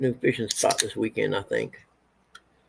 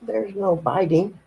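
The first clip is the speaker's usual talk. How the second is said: drawn out stern warning; slow it down, stern; slow firm scolding; 7 semitones higher, slower than usual. Real, not acted. Scolding a biting cat again.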